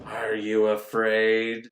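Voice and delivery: mock spooky intimidation; spooky possessed voice. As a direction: play a hammy ghostly queen, drawing out each word. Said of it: "she's saying spooky, cryptic shit".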